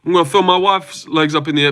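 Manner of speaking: quick, high comic sidekick impression; Derek Lewis. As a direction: rattle off a crude boast in his put-on voice.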